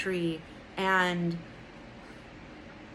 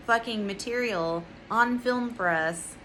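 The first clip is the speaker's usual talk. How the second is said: ordinary talk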